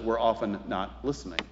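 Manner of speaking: ordinary talk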